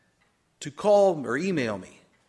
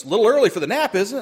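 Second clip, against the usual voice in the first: higher, louder teasing jab; teasing friend; 6 semitones higher, 7 dB louder, much faster than usual. Retold or imitated. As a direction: rib him about napping. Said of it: "He goes".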